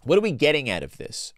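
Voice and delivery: ordinary delivery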